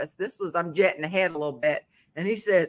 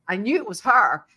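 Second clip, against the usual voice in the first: usual speaking voice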